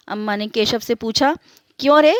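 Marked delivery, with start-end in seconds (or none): 1.8-2.2 a sharp, loud, high "क्यों रे?"; scolding. A narrator's reading. Mother scolds Keshav, sharp and loud. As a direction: snap it at the guilty boy.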